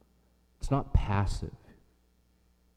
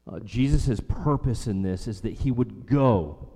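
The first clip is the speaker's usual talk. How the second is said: ordinary delivery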